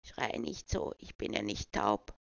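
put-on lightly croaked frail old voice; a hint of a doddering grandpa; creaky trembling old man. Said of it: "mit krächzender, zittriger Stimme".

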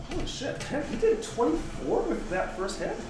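high, stunned outburst; astonished exclamation. Real, not acted. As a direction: gasp it out in disbelief. Forty-nine damage announced.

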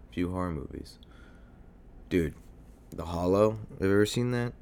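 usual speaking voice

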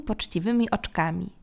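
usual speaking voice

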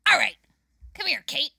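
lightly voiced crackhead waitress. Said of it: "both of your guys' crackhead voices".

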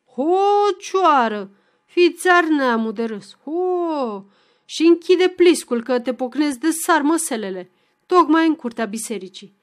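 loud threatening outburst; angry outburst. A narrator's reading. Ion snapping back at the cursing fiddler, angry and loud. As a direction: blow up and threaten him. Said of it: "izbucnește răgușit".

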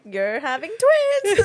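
mock announcement. Retelling the doctors' twin news, slow and loud. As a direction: announce it like big shocking news.

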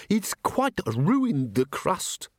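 squeaky, slow, fussy little man; prim, stammering museum curator. Acted. Ghost curator speaks.

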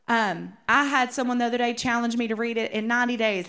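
ordinary talk, nothing marked

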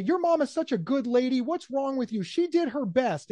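mock outrage in defense of mom; indignant defender. Shown as a demonstration. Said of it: "And somebody".

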